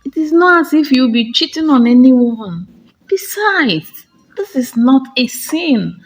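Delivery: pleading sister. Acted. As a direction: press her sister, half desperate. Chiamaka begging her sister.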